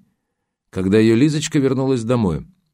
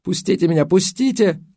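high desperate cry of let me go; a high, pleading sleep-cry; 10 semitones higher than usual. A narrator's reading. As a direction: cry out from a nightmare, pitched high. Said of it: "один раз выкрикнула".

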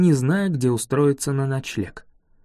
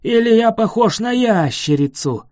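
loud, high offended boom; dragon roar; 5 semitones higher, 4 dB louder than usual. A narrator's reading. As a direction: bellow it as an insulted beast. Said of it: "взревел дракон".

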